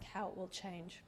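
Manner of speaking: usual speaking voice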